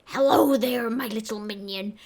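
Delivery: a hint of raspy villain; imitating raspy voice. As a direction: lightly rasp the boss line. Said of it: "I did, like, a raspy".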